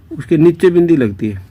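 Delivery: ordinary talk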